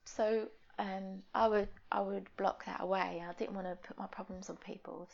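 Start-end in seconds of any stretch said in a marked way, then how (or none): 3.3-5.1 soft unvoiced aside; whisper. Real, not acted. Admitting to a friend, whispered. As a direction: breathe it out quietly.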